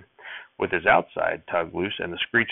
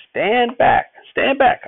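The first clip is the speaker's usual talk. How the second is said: much higher, much louder furious bark; angry shout; 8 dB louder, much slower than usual. A narrator's reading. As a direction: snap it at the boy, full force. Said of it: "Grandfather snapped".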